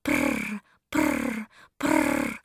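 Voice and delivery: low rolling cat purr. Acted. Mother cat purring.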